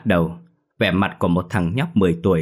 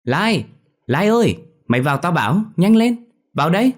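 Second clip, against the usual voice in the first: put-on high, loud childish call across the yard; boy calling a friend in from the schoolyard, loud and high; 11 semitones higher, 5 dB louder than usual. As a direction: shout for your friend through the classroom door. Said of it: "thọc đầu ra cửa lớp mà kêu lớn".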